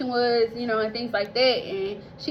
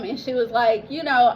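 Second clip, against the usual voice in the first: ordinary talk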